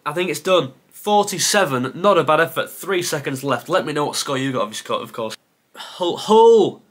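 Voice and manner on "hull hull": loud, high outburst on the second word; dismayed realisation. Real, not acted. Quiz player seeing the team he missed, dismayed. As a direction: blurt it out as it dawns on you.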